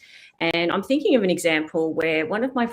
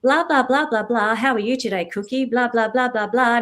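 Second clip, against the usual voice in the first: higher, thin voice with the bass taken out; voice without bottom end; 5 semitones higher, 4 dB louder than usual. Shown as a demonstration. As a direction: strip the bottom end out of your sound. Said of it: "take away that bottom end".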